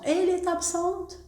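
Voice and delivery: "elle est absente" lifted high, asked as a question by tune alone; question intonation. Shown as a demonstration. Teacher showing students that intonation alone marks the question. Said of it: "كنعرفوها بالintonation".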